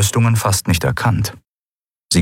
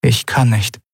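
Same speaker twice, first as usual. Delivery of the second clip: higher, restrained distress; voice near breaking; 5 semitones higher than usual. A narrator's reading. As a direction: hold back a breaking voice. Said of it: "dass ihre Stimme brach". (mit mühsam beherrschter Stimme)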